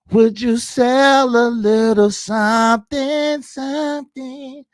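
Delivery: stretched, teasing slow drawl; slow sing-song coaxing. Real, not acted. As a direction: coax it out slowly.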